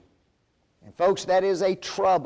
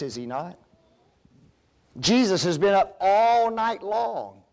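ordinary talk, nothing marked

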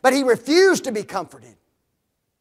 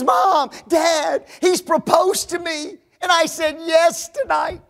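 squealing, higher daughter voice; excited announcement; 8 semitones higher, 3 dB louder than usual. Retold or imitated. Daughter announcing her engagement to her parents, thrilled. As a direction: burst out the happy news.

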